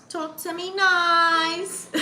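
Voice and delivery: high, drawn-out playful croon; playful drawl. Pretend. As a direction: stretch it out high, teasingly. Playful echo of her advice.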